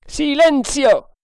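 high, loud, stretched order; thundering command. A narrator's reading. Quartermaster silencing the Indians, thundering. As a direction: thunder over the crew's cries. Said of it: "tuonò il quartier mastro".

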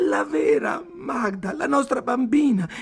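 high, loud, crying insistence; distraught child's wailing outburst; tearful shouting. A narrator's reading. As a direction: insist through tears, pitched right up. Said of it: "gridò Ayla in lacrime".

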